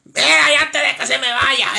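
loud, insistent call for attention; scolding interruption. Acted. Caller stopping the host before goodbye, loud scolding. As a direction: burst in loudly to stop them leaving.

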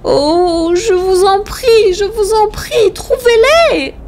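high, drawn-out anguished plea; desperate pleading, pitched very high; cry of suffering. A narrator's reading. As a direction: beg in a high wail, stretching the words. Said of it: "Elle poussa une sorte de cri de souffrance".

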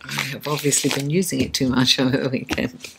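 hoarse from overuse; a tired, scratchy voice; raspy voice. Real, not acted. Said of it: "excuse my, uh, raspy voice".

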